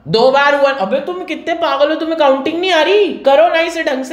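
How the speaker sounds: high-pitched, exasperated teacher's rebuke; exasperated scolding. Real, not acted. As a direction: scold the class for botching the count. Teacher scolds students for miscounting.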